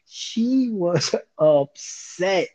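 slow, laughing emphasis; laughing, drawn out. Shown as a demonstration. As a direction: stretch it out through a laugh. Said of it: "when I tell you".

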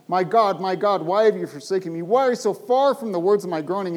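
loud, pleading cry to God; loud anguished plea from the psalm. Acted. Preacher voicing the psalmist's anguished cry.

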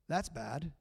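quiet, dismissive shrug of a line; indifferent shrug. Retold or imitated. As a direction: say it like it's no concern of yours. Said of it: "you don't just go".

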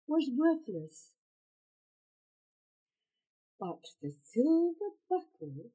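usual speaking voice

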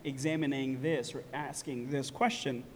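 low, subdued mock musing to oneself; asking oneself. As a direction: quietly voice a listener's private doubt, haltingly. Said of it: "might be asking to yourself".

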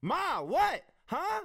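retold shout down the phone; loud talking. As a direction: holler to someone hard of hearing. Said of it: "I'm talking loud for me".

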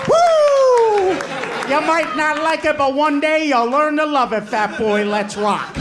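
trash-talk it like a wrestling entrance; cocky mock wrestler. Brother's cocky wrestler-style entrance.

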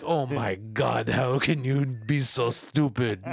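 slow, drawn-out mock Indian accent, exasperated. Scammer insults the victim, slow exasperated mock Indian accent. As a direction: imitate the scammer's angry Indian accent, stretched out slowly. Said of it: "In my best Indian accent".

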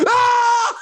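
sudden high frustrated yell. Real, not acted. In the car, angry at the guard: high yell. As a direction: let out a sharp angry yell. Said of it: "It got me just".

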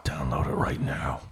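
unvoiced whisper aside. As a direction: breathe it like a secret. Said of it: "That was [name]".

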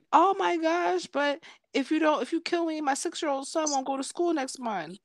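high-pitched mock panicked plea; mock pleading. Imagined victim begs her not to kill him, mock pleading. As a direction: beg for your life, hammed up.

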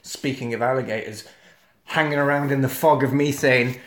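normal talking voice

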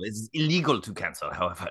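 mock-official French-accented claim. Fake Frenchman claiming a subscription cannot be cancelled, French accent. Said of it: "apparently in France".

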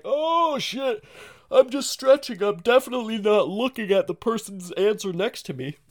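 high, strained stretching voice; faking a stretch. Acted. Pupil pretending to stretch while cheating, strained. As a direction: groan it out as if mid-stretch, overdone. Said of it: "you look over, you're like".

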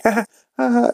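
high, soft mock laugh; quiet high-pitched put-on ha-ha; imitating Lois's funny laugh. Wife as her head gets licked, funny high giggle. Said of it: "funny laugh that she does".

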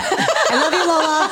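high, laughing I love you; laughing shout-out. Real, not acted. Calling out to Lola through laughter, laughing. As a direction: laugh it out to an absent friend. Teasing shout-out to absent coworker.

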